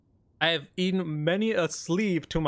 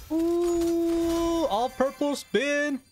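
excited ooh; 10 semitones higher, much slower than usual. Real, not acted. Excited at a rare spin.

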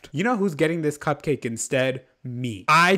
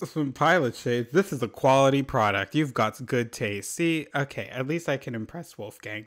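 ordinary delivery

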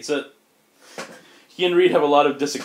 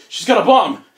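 panicked mock yell, higher and louder; soldier yelling; 7 semitones higher, 8 dB louder than usual. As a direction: shout the warning in alarm. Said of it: "they're like".

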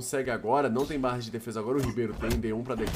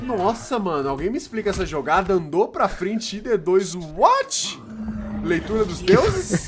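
amazed, high and loud; shocked at the play, half laughing; excited; 5 semitones higher, 4 dB louder, slower than usual. Real, not acted.

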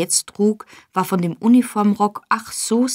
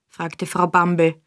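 usual speaking voice

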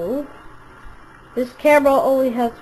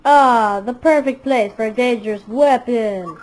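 ordinary talk, nothing marked

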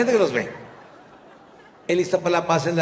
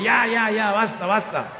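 mock stop shout; 8 semitones higher, slower than usual. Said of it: "le dije".

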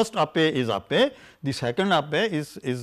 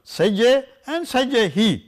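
slow, "sajje hi" pitched higher and louder; stress contrast; 7 semitones higher, 4 dB louder, slower than usual. Shown as a demonstration. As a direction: drag both, push up on "sajje hi".

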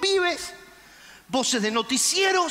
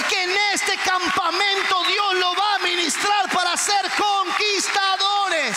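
preacher's triumphant high-pitched cry; full-force preaching; 7 semitones higher, 4 dB louder than usual. Acted. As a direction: rouse the congregation at full force.

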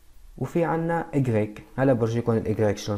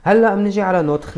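much higher and louder, calling attention; loud restart; 7 semitones higher, 8 dB louder than usual. Shown as a demonstration. Teacher loudly pulling the class to the next point.

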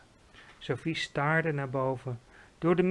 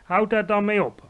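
higher, louder curt retort; snappish; 5 semitones higher, 7 dB louder than usual. A narrator's reading. Giant silencing Sophie as he hunts, snappish. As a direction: cut her off tersely. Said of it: "antwoordde de G V R bits".

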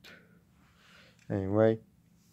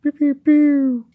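quick high pews, last one drawn out; laser pew sounds; 5 dB louder, slower than usual. Pretend. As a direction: fire off squealed laser shots, loud and high. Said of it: "like they do in the series".

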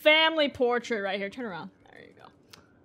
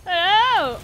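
ordinary talk

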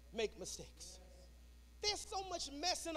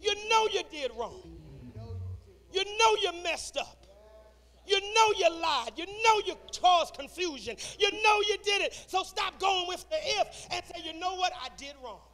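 higher, emphatic repeated exhortation; insistent preaching rebuke; 6 semitones higher, 10 dB louder than usual. Real, not acted. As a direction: press each accusation home to the congregation.

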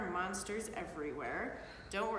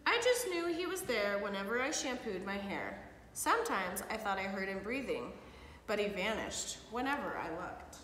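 put-on lively read-aloud for kids; storybook reading. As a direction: read it like bedtime story to children. Parent reads picture book aloud.

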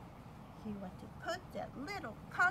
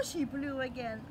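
ordinary delivery

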